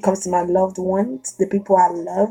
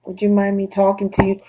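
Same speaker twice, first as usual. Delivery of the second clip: polite soft approach; 7 dB quieter than usual. Retold or imitated. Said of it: "But if you're like".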